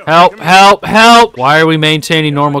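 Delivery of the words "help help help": louder rising calls for help; calling for help. Real, not acted. Gamer calling on the gang to help, louder rising calls. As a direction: call out louder each time. Companions getting choked out.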